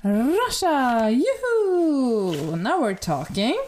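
gleeful drawn-out cheer for a favourite; thrilled envelope reveal; excited announcement. Real, not acted. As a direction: announce it with a swooping whoop. Announces a favourite country getting through.

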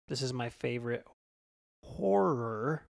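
ordinary talk, nothing marked

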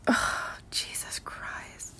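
breathy whispered groan of exasperation; whispered exasperation at obvious questions; exasperated whisper. Real, not acted. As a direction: hiss it under your breath, fed up. Exasperated aside.

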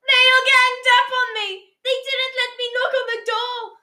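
put-on very high-pitched little-boy whine; whining. A narrator's reading. Small boy tells on family to his aunt, high whine. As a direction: whine like a child telling tales. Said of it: "whined Michael".

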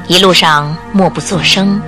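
ordinary talk

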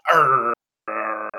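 growly dog voice; dog growl. Retold or imitated. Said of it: "And he'd be like".

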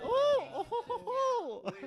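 put-on very high, airy French-girl cooing; high-pitched dainty "ooh, oh, oh"; French schoolgirls. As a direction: coo like a swooning French schoolgirl. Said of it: "those French girls from Harry Potter".

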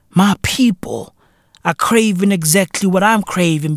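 ordinary delivery

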